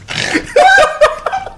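laughing with a snort; snorting laughs. Real, not acted. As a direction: burst out laughing through the nose. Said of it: "Did you just snort?".

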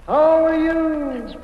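slow echo call back; metallic flutter echo. Acted. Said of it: "just listen to this echo".

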